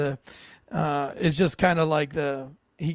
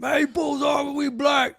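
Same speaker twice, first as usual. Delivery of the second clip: put-on drunk passenger showing off; 8 semitones higher than usual. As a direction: play it up like a drunk trying to be extra. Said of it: "Trying to be like".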